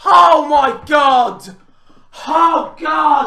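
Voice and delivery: normal talking voice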